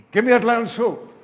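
loud, high impatient demand. Acted. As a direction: demand the food like a famished man. Famished brother demands soup.

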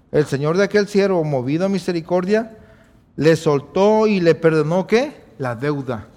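ordinary talk, nothing marked

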